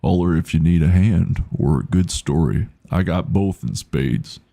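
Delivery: slow, drawling cowboy-style voice; Sam Elliott. Acted. Streamer reading shopkeeper's lines, Sam Elliott inspired drawl. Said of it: "in a Sam Elliott inspired voice".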